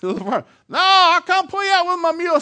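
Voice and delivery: high, loud farmer's holler; insistent yelling back. Retold or imitated. Farmer insists to the stranded coach, insistent yelling. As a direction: holler it stubbornly across the field.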